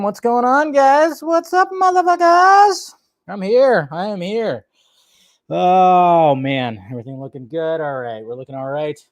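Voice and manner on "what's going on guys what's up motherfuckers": high, loud, drawn-out stream welcome; hyped greeting. Real, not acted. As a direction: shout it out to the whole chat like a hype man. Streamer hyping up chat greeting.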